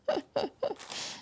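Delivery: bright high giggle; high laugh. Real, not acted. Laughing at her own messy craft table, high giggle. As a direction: laugh at the pile of mess.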